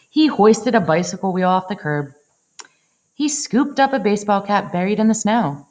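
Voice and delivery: ordinary delivery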